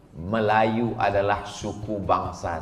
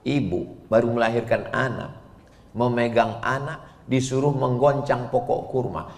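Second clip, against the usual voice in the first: usual speaking voice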